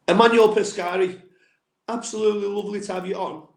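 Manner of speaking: normal talking voice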